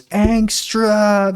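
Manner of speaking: a hammed-up yelled name; mock shout of Angstrom. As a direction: shout the name, imitating Mark. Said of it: "He does the same yell".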